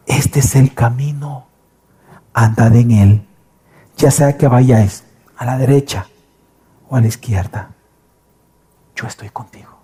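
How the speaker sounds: low, hushed voice, dropping to a whisper; God whispering in the ear. Acted. God speaks softly into his child's ear, hushed and low. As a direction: murmur gently into the listener's ear. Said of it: "como que si alguien se acerca a ti y te hablara al oído".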